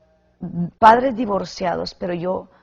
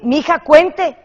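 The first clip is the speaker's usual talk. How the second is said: higher, louder mock parent; imitated gossiping parent; 8 semitones higher, 8 dB louder than usual. Mocking a parent who would badmouth the other, pitched up.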